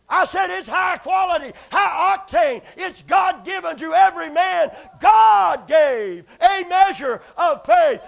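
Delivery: loud, high, shouting sermon; fiery preaching. Acted. Preacher shouting faith to the church, full force. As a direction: preach it hard to the congregation.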